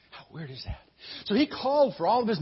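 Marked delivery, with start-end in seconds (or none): 0.1-0.8 softer, lower mock bafflement; quiet retold disbelief; musing to himself. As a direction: repeat it under your breath, as if puzzled. Retold Pharaoh repeating it to himself.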